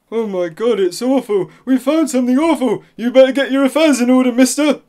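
loud, high, fast panicky warning; panicked alarm. Acted. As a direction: blurt out the bad news in alarm. Doctor panics over finding.

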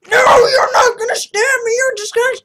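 high, loud crying protest; panicked screaming. Real, not acted. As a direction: scream at the attacker. Being killed in game.

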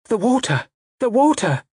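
very high, alarmed cry; terrified young lady's high cry; frightened cry. A narrator's reading. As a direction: cry out in panic, pitched right up. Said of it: "there came a cry from Frida".

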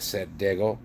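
ordinary delivery, nothing marked

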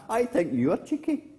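high echo, lifting at the end. Acted. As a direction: answer back high like an echo. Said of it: "And the voice came back".

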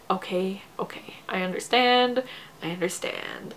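half-whispered, very soft self-quote; hushed realization. Retold or imitated. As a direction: let it dawn on you under your breath. Acting out her dawning understanding.

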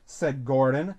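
usual speaking voice

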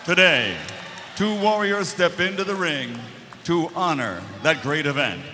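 booming boxing ring announcer. Acted. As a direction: build it up like a big fight intro.

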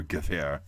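Gewehr as guh-VEH-ah, low and soft; W as V in Gewehr, final R dropped; German pronunciation. Shown as a demonstration. Repeating the actual pronunciation, Gewehr soft with V. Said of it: "It's actually pronounced".

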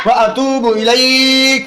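ইলাইক drawn out slowly; slow recitation. Shown as a demonstration. Teacher leading the dua: slow recitation. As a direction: chant it slowly for the class. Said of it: "teacher reciting prayer for repetition".

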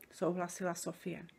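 usual speaking voice

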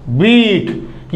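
बीट with the long ई stretched out very slowly; drawn-out demo. Shown as a demonstration. Teacher to students, stretching बीट slowly. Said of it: "तो क्या हो गया हमारा ये?".

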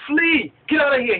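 high, angry dismissal; vexed. Acted. Balak orders Balaam away to his place, vexed. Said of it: "He's vexed, he's angry".

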